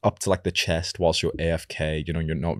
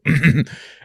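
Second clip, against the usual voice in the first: sharp, pointed throat clear; loud deliberate clearing of throat; throat clear signal; 10 semitones higher, 9 dB louder than usual. Retold or imitated. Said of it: "just look at each other and just go".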